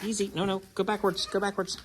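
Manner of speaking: soft, calm coaxing; soothing a scared dog. Real, not acted. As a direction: gently settle a spooked animal. Calming a nervous animal.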